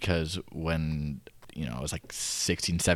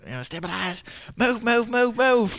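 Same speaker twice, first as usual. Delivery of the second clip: high, loud Southern coaching calls, hammed up; Southern coach accent; 13 semitones higher, 6 dB louder than usual. Imitating the coach calling drills to kids in a Southern accent. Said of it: "a slight Southern accent".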